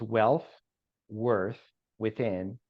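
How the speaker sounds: spaced out word by word; very slowly. Shown as a demonstration. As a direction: say each word separately, with clear pauses. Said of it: "make sure to say that very slowly".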